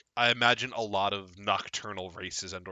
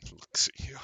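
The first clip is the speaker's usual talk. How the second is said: quiet growl; 4 semitones higher, 3 dB quieter, faster than usual. Acted. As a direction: breathe it out quietly. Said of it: "growls very quietly".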